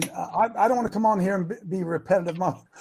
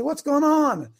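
higher, insistent locker-room question; coach's demand; 7 semitones higher, 4 dB louder, slower than usual. Acted. Coach to his assistants at halftime, demanding. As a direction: demand an answer from the staff.

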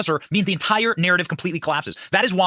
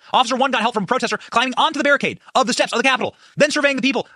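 ordinary talk, nothing marked

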